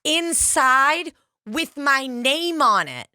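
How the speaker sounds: high, slow syrupy politeness; sweetly drawn-out taunt; fake sweet. [name] taunting the women with the napkin, slow and syrupy. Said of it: "I'm saying, I go".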